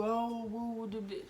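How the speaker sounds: cooing baby talk, hammed up a little; mocking sing-song coo to soothe a pouting boyfriend; mock baby-coaxing. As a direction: pretend to coo at a sulking grown man. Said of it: "I'm not about to baby you... coax you".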